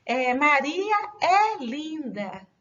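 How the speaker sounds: slow demo with "é" as open, stressed "eh"; slow, careful teacher demo of the verb "é". Shown as a demonstration. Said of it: "Vejam, esse é acentuado".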